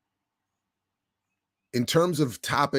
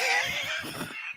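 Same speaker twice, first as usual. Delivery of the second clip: real burst of laughter; 5 dB quieter than usual. Real, not acted. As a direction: crack up at the memory. Laughing at own story.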